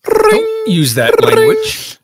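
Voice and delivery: high, slow, prissy scolding; dad scolds his son in a shrill falsetto. Acted. High slow scolding voice.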